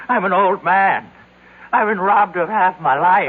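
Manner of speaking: a desperate lament; anguished outburst. Acted. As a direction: cry it out as if robbed of everything. Frantic old man protesting.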